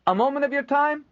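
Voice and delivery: high, loud call across the yard. Acted. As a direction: call out from the wagons. Trader calls from wagon.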